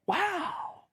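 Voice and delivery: high, drawn-out wow; taken aback. Acted. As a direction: marvel at how little they know. Instructor faces a clueless new driver.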